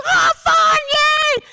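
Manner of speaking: very high, gleeful dog voice; excited dog. Acted. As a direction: squeal it like an overjoyed dog. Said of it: "Katie, the Wonder Dog, goes".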